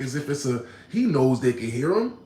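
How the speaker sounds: normal talking voice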